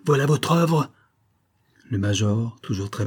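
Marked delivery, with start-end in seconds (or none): 0.0-0.9 angry accusation. Acted. Saisissant le major à la gorge.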